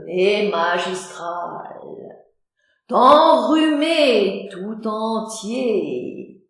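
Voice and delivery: very slow, grand and solemn; emphatic. Acted. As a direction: stretch every word grandly.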